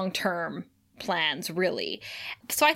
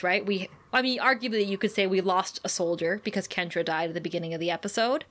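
ordinary talk, nothing marked

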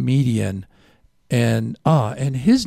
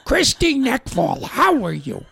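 Gilbert Gottfried; 12 semitones higher than usual. Acted. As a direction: screech it out far above your normal pitch. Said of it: "That's my Gilbert Gottfried".

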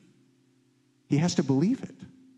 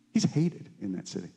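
usual speaking voice

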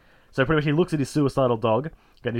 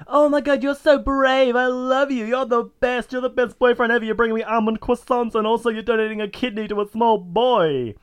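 put-on high, breathless gushing girlfriend voice; mocking a lovestruck girlfriend; 11 semitones higher, 5 dB louder than usual. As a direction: swoon over him in a high, loud voice. Girlfriend gushing over hunk.

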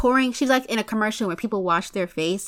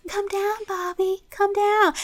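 ghostly voice; 8 semitones higher, slower than usual. Retold or imitated. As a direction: coax him down like a ghost. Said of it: "the ghost is like".

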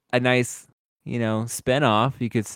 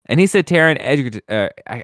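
normal talking voice

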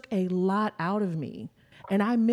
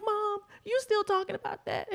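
tearful quote; 13 semitones higher than usual. Retold or imitated. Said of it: "And she was like".

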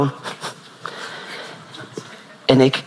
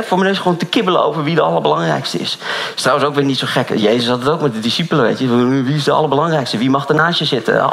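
ordinary delivery

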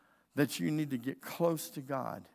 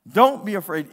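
loud, higher-pitched order; forceful command; 5 semitones higher, 13 dB louder than usual. Retold or imitated. Preacher retells the order given to his son, loud command. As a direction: punch it out hard as a command. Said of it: "It was a command".

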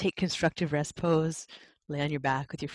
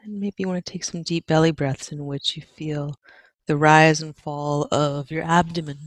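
ordinary talk, nothing marked

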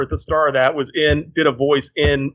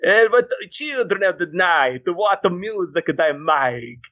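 put-on Borat accent; 2 semitones higher, slower than usual. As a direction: drag it out in a Borat voice. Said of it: "They sound like Borat".

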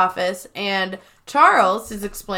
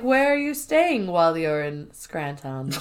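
lower, coy voice, "Scranton" ending in "tahn"; demure, ladylike; 5 semitones lower than usual. Retold or imitated. Kelly flirting with Charles, mock demure. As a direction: act daintily ladylike, affected last word. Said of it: "trying to be demure".

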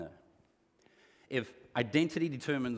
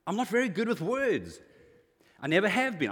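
pleading Moses; 6 semitones higher, 4 dB louder than usual. Acted. Preacher acting Moses' plea.

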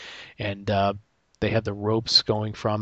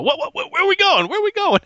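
panicked grandfather; 6 dB louder than usual. Retold or imitated. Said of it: "you hear my grandfather go".

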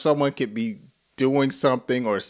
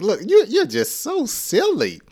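high-pitched amused teasing; playful teasing; 11 semitones higher than usual. Acted. Teacher shows how to reply to a silly friend, playful teasing. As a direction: tease them in a high, animated voice.

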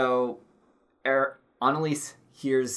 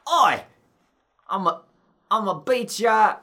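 high, loud mock Cockney street urchin; Cockney Artful Dodger; 7 semitones higher, 5 dB louder than usual. Podcaster doing the Artful Dodger Cockney to describe the poodle. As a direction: threaten like a cheeky London pickpocket. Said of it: "more artful dodge".

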